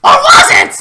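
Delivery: high, loud teasing question; dramatic twist. Acted. Teasing viewers with a dramatic loud twist. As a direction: ham up the twist.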